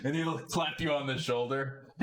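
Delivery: high, laughing banter; jovial fan. Acted. A shifter joking about his lost money, high and laughing. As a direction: laugh it off heartily.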